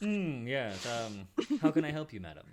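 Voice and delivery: lightly voiced prim librarian; a hint of a stuffy scholar; bookish librarian. Librarian greeting the visitor, lightly prim. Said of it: "looking significantly more bookish".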